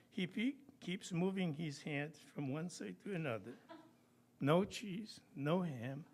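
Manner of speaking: chuckling as the joke lands; laughing through punchline. Real, not acted. Poet laughing at his own mouse joke punchline. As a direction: laugh while delivering the punchline.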